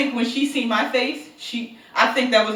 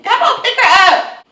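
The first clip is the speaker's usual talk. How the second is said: high, loud, drawn-out holler; urgent call, retold; 13 semitones higher, 13 dB louder, slower than usual. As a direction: call out for help, playfully re-enacted. Retells calling out after the fall.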